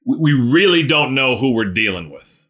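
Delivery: ordinary talk, nothing marked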